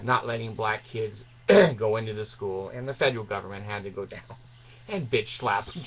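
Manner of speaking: ordinary talk, nothing marked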